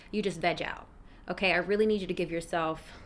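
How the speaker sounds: usual speaking voice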